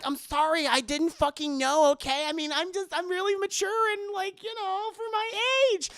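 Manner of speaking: put-on high defensive girl voice; lightly voiced teen girl, excusing herself; girly voice.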